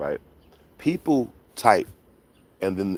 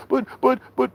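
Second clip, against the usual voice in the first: squeaky stammer. Retold or imitated. Caller stammering.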